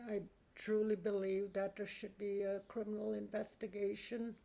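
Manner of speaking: ordinary delivery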